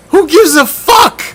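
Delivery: high, loud scoffing yell; incredulous shout. Real, not acted. Ranting at preseason hype, incredulous shout. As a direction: blast the question in disbelief.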